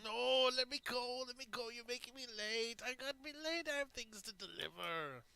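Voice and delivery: high, whiny begging; high pleading captive. Acted. Prisoner pleading with captors to let him go, high whine. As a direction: beg to be freed, voice pitched high.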